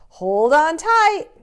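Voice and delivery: put-on Father Christmas calling to the children, excited. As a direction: call it out as the sleigh lifts. Father Christmas as the sleigh takes off.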